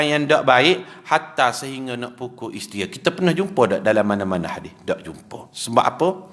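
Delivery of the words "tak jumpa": ordinary delivery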